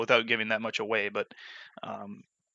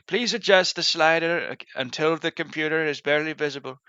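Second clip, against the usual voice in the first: higher, louder robotic British announcer; automated British narrator; 5 semitones higher, 3 dB louder than usual. Retold or imitated. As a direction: read it like a game narrator. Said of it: "In order to nail impressions".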